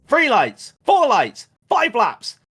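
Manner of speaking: high, loud start-line countdown in a commentator's voice; Murray Walker commentary. Retold or imitated. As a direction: count the lights out like a thrilled race commentator. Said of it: "lots of Murrayisms".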